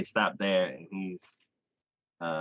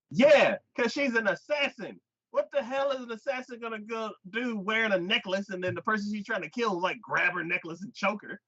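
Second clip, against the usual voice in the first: high-pitched incredulous outburst; exasperated rant; 11 semitones higher than usual. Retold or imitated. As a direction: rant at a clueless character, punching the first word. Own exasperated rant at the character.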